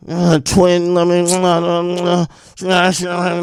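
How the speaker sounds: mumbled, slurred mockery of speech; mush-mouthed. Mocking a rapper who can't pronounce words, mush-mouthed. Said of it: "he cannot pronounce them".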